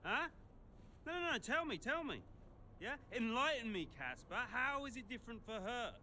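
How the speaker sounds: high, goading demand; angry challenge. Acted. Demanding that Casper explain the difference, angry and goading. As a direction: press hard, mockingly insistent. Acted quarrel, demanding an answer.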